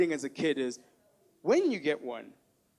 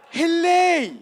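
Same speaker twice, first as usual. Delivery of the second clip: put-on loud high hello said hele in an African accent; dad's hello; 8 semitones higher, 8 dB louder than usual. Comedian doing his dad answering the phone, shouted accented hello. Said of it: "mix up E's and O's".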